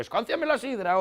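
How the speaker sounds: loud, high-pitched country bumpkin demand; boorish yokel. Acted. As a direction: bawl it like an uncouth yokel at dinner. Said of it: "un pacón cazurro y te dice".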